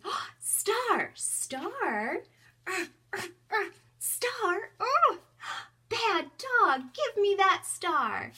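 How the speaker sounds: high, loud, hammed-up hunt that turns on a naughty puppet dog; playful search for the star. Shown as a demonstration. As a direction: overplay the hunt, then scold the dog for the kids. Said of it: "I look for the star and say".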